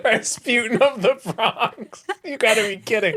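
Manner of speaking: amused disbelief. Real, not acted. Echoes nickname in disbelief.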